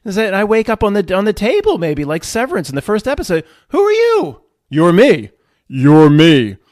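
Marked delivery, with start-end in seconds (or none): 3.7-4.4 high, louder startled question; bewildered newborn soul. Acted. Little [name] waking up, acted. 4.7-5.3 a firm, raised-volume answer. Acted. Higher self answers little [name]. 5.7-6.6 loud, slightly deeper booming answer. Acted. Higher self repeats, more imposing.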